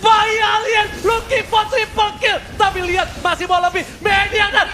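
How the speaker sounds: loud, high hype shouting; fighting game announcer. Real, not acted. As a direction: call the kill streak at full volume. Caster calls a multi-kill.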